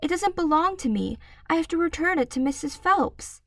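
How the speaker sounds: put-on high anxious child; a girl worrying about the library; high earnest girl; little girl voice. A narrator's reading. Matilda voiced by narrator.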